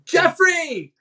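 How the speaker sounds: high, loud correcting yell; shouted name. Retold or imitated. Member shouting her name up to the director. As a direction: yell your own name up to the box.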